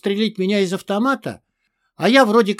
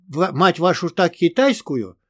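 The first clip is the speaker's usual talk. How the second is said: usual speaking voice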